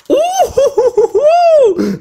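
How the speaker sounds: very high, slow hooting cheer; a hammed-up excited hoot; mock hoot. Imagine un Charizard, s'emballe. (hululement exagéré, très aigu)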